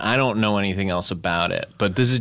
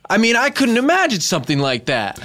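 mock horror; 11 semitones higher, 3 dB louder than usual. Mock horror at confessional show.

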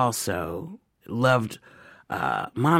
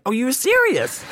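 much higher and louder astonished question; retold surprise; 14 semitones higher, 6 dB louder than usual. Learning Nichols found a theater, high astonished surprise. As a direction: realize with a jolt he meant it. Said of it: "I was like".

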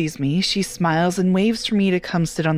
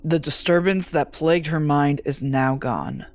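plain reading voice

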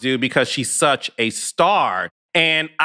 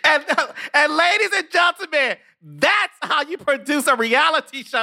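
big, higher showman announcement; announcer call; 11 semitones higher than usual. Acted. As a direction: belt it out like a game show host. Host announcing to the audience.

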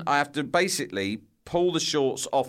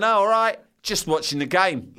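high, breezy cover-up; faux casual; 7 semitones higher, 4 dB louder than usual. Stuck boy answering the girls, fake-casual and high. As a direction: play it cool, pitched high. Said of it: "I'm going".

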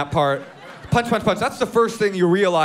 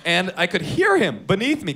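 ordinary talk, nothing marked

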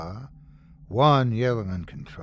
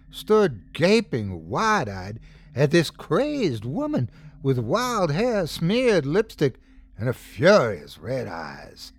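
plain reading voice

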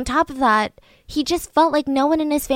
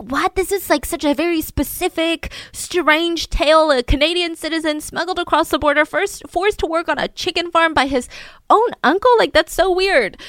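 higher, stunned disbelief; incredulous cops; 6 semitones higher than usual. Retold or imitated. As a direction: mimic baffled officers. Police reacting in disbelief.

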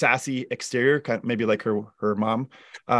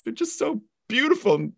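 put-on much higher, melting over the kids; tender gushing; 10 semitones higher than usual. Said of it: "I'm at a red light and I'm like".

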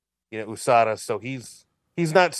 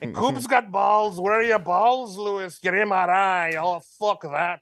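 put-on goading Irish accent, pitched slightly up; hammed-up Irish trash talk; Conor McGregor impression; 3 semitones higher than usual. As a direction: taunt them in a put-on Irish brogue. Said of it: "Do we have Connor on the line".

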